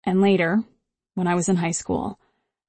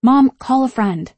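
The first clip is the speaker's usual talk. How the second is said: pleading; 5 semitones higher, 5 dB louder than usual. Acted. Daughter pleading mid-fight.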